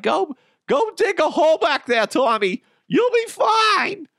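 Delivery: high-pitched, hammed-up parent calling out; carefree parent. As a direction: send the kid off in a loud, high mock-parent voice. Imagined parent sending kid outside.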